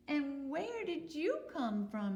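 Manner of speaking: slow, high bunny voice; a puzzled little bunny. Acted. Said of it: "he said to the duck".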